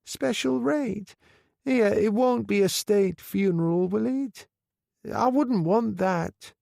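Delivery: put-on high, slow frail old lady voice; a grieving old woman, high and slow; old widow. A narrator's reading. Old widow asking the undertaker, high and slow.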